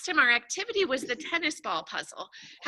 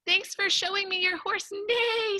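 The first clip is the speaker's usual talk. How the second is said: horse voice; 7 semitones higher than usual. Acted. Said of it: "says the horse".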